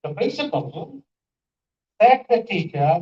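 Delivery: "principal" left hanging, then "said the teacher" grouped; moved pause. Shown as a demonstration. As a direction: break after "principal", "said the teacher" as an aside. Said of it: "I change the location".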